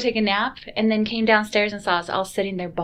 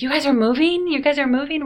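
higher, louder incredulous repeat; surprised question; 6 semitones higher, 5 dB louder than usual. Retold or imitated. Church members asking the kids if they're moving, surprised. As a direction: act stunned by the news.

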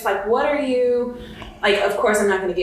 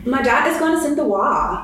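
higher-pitched put-on foreign accent; Anna Delvey accent; 6 semitones higher than usual. Co-host imitating Anna Delvey about her dad's money, put-on accent. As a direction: mimic a haughty heiress's odd accent. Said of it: "go ahead and do Anna Delvey".

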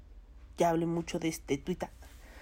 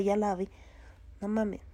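ordinary delivery

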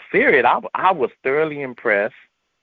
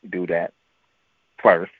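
ordinary delivery

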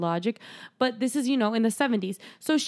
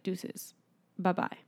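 whispered, much softer goodbye; breathy mock farewell; 3 semitones lower, 10 dB quieter, slower than usual. Michael whispering goodbye as he drives away. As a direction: toss off a whispered bye. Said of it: "He's like".

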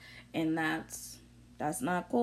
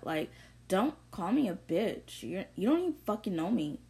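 normal talking voice

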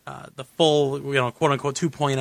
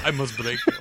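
lightly done Russian boxer voice; a hint of a stern Ivan Drago; Drago impression; 2 semitones lower than usual.

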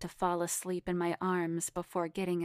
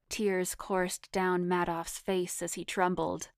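ordinary delivery

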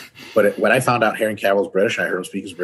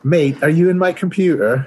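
high, loud mock British accent; hammed-up British, pitched well up; dog-shit British accent; 9 semitones higher, 4 dB louder than usual. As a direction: put on a bad British accent. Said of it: "was that a dog shit British accent".

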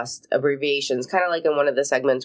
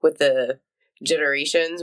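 normal talking voice

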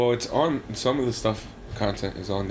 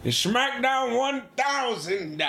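high, slow hype announcer; 12 semitones higher, much slower than usual. Pretend. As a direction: stretch it out like a ring announcer. Hyping the show's big episode.

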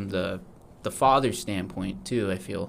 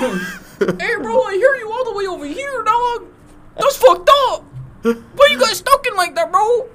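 very high, loud whiny voice; whiny neighbor; 8 dB louder than usual. Retold or imitated. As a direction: squeal it like an offended neighbor. Neighbor complaining.

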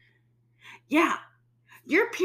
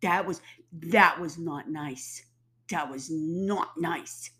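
slow scolding; 2 semitones lower, slower than usual. Real, not acted. Slow scolding of Mother Nature.

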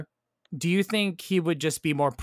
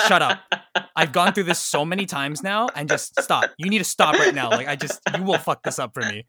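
mock exasperated Rory; 4 semitones higher, 6 dB louder than usual. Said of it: "Just like".

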